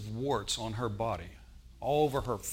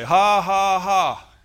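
loud, higher, deliberate ha ha ha; forced laugh; 7 semitones higher, 13 dB louder, much slower than usual. Retold or imitated. Norval laughing at his problem by faith, forced and loud. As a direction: laugh on purpose with no joy in it. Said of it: "He didn't feel like laughing".

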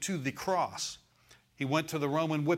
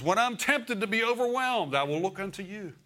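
quoting David; 7 semitones higher, 4 dB louder than usual. Retold or imitated. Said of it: "David even said".

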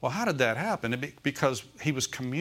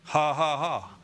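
spoken-out laugh, each ha separate; deliberate mock laugh; 2 semitones higher, slower than usual. Shown as a demonstration. Preacher showing how to laugh at destruction, deliberate laugh. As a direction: laugh on purpose, by faith rather than amusement. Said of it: "you need to learn to laugh".

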